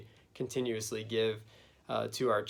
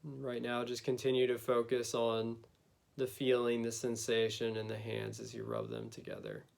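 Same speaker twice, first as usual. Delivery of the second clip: guided meditation. Acted. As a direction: gently lead them inward, unhurried.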